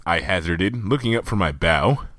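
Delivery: usual speaking voice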